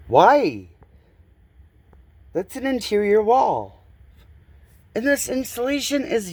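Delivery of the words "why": loud, exasperated why; a fed-up homeowner's loud why. Real, not acted. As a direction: throw the question out in disbelief. Exasperated at needless insulation.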